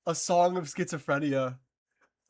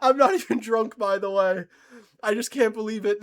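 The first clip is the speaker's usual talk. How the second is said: laughing; 5 semitones higher, 7 dB louder than usual. Real, not acted. Still laughing, loud.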